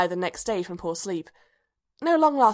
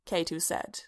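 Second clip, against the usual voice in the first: ordinary delivery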